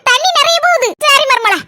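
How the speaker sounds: ordinary talk, nothing marked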